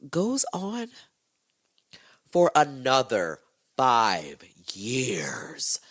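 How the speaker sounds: slow whispered for another five years; dramatic whisper. Real, not acted. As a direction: drag it out in a spooky hush. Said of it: "dramatic whispered delivery".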